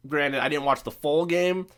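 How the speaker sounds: ordinary talk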